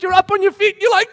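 usual speaking voice

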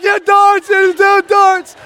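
dad mimicking his sons; mock boys. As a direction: ham up careless boys. Boys voiced in story.

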